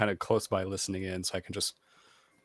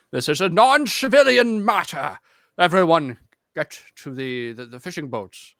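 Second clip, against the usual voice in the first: commanding announcement; 5 semitones higher, 7 dB louder than usual. Pretend. First mate ordering passengers to the boats, loud official tone. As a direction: announce it to the crowd with authority.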